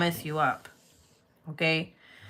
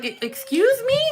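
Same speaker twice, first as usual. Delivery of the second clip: much higher, louder offended question; indignant excuse me; 4 dB louder than usual. Retold or imitated. Daughter to mom, offended, much higher and louder. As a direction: take offence. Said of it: "I was like".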